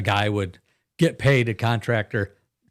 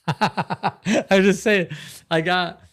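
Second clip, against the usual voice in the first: laughing; 7 semitones higher, much slower than usual. Real, not acted. Put on the spot, laughing.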